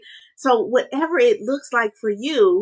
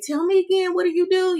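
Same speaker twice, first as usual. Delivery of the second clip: higher, eager plea to the brother; eager asking brother; 9 semitones higher than usual. Retold or imitated. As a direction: pitch it up like a keen little sibling. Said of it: "I'm asking him".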